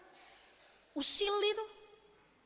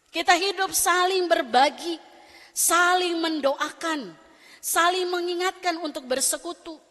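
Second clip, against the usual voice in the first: louder, rousing sermon call; preaching; 7 dB louder, slower than usual. Acted. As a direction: exhort the congregation with force. Preaching exhortation. (khotbah berapi-api)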